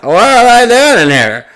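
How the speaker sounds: high, loud caricature of gawkers; hammed-up nosy old-timer cry; mock indignant onlookers. Voicing staring old people, mocking.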